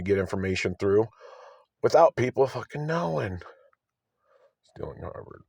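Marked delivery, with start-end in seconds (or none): 4.8-5.5 breathy whispered title then a laugh; whispered aside. Real, not acted. Vlogger whispering a title to himself, then laughing. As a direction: whisper it to yourself and chuckle.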